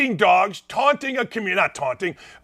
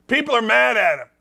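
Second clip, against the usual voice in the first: ordinary talk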